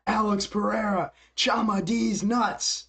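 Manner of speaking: high, slow mock Huckett taunt; drawn-out jeering impression; mocking fighter's trash talk; mocking quote. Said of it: "he said".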